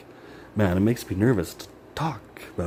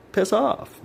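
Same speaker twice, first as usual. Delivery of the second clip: exasperated; 14 semitones higher than usual. Real, not acted. Frustrated outburst.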